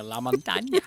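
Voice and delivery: LaMontagne echoed as "la-mon-TAHN-ya", rising at the end; imitated mispronunciation. Co-host predicting the friend's joke, imitated mispronunciation of LaMontagne. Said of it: "I knew for a sec-, a fact you were gonna go".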